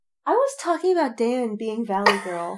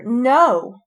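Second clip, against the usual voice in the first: loud; 8 dB louder than usual. Real, not acted. Reacting to video moment.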